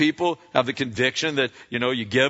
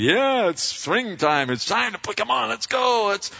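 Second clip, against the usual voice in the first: higher, eager call to start planting; cheerful farmer; 10 semitones higher than usual. Acted. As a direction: sound thrilled it's spring. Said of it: "he hilariously plants seed".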